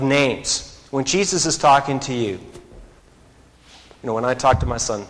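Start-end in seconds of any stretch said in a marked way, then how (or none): none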